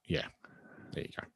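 ordinary delivery

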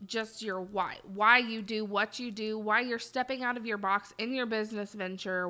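normal talking voice

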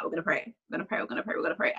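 put-on quietly calm the panicking teen, over and over; softly repeated vow. Mum to her frightened teen daughter, quietly repeating the promise to pray. Mum's answer to everything is prayer.

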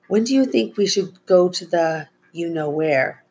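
usual speaking voice

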